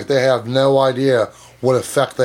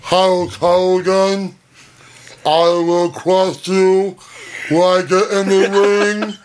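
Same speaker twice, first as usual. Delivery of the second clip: slow, higher wrestler promo voice; Andre the Giant voice; 5 semitones higher, much slower than usual. Retold or imitated. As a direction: drag out each word like a giant wrestler. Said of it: "Andre the Giant?".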